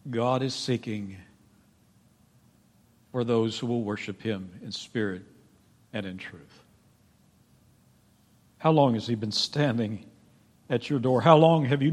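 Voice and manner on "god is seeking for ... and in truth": soft saying. Acted. Jesus speaking softly at well.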